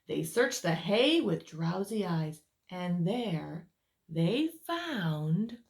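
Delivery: lively read-aloud voice for little ones; storyteller reading to children; story narration. A narrator's reading. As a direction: tell it like a bedtime picture book. Reads picture book aloud.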